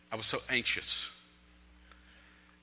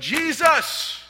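full-force high cry for help; yell; 13 dB louder than usual. Retold or imitated. Man in his car calling out to Jesus, desperate yell. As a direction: yell the name out at the top of your voice. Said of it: "and I just yelled".

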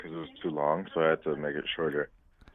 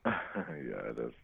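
low magnetic voice; very deep, low voice; 6 semitones lower, 8 dB quieter, much slower than usual. Real, not acted. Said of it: "your voice is deep as hell".

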